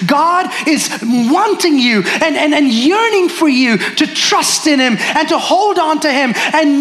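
high, driving sermon delivery; impassioned preaching. Real, not acted. Preacher urging congregation to trust God, impassioned preaching. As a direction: press it home fervently, pitch held high. Preaches application at full force.

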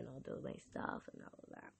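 voiceless, almost no sound; words barely come out; voice gone. Real, not acted. Host explaining she lost her voice, voiceless. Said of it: "I lost my voice".

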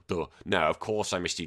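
usual speaking voice

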